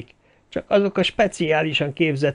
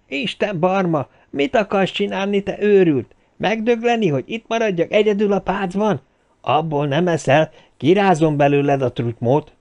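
furious tirade; 6 semitones higher than usual. A narrator's reading. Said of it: "üvöltötte Tilford".

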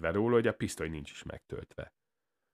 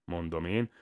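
plain reading voice

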